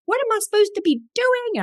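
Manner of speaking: high, baffled inner question. Retold or imitated. To herself while staring at the candle, a high baffled question. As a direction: squeak it out, lost and confused. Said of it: "I'm like".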